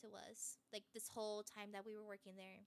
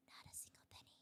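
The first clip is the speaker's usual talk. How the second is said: whispered aside, voice dropping to a breath; 13 dB quieter, much faster than usual. Real, not acted. Telling viewers the unpaid co-CEO secret in a whisper.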